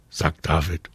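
usual speaking voice

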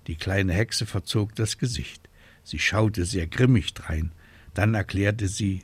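ordinary delivery, nothing marked

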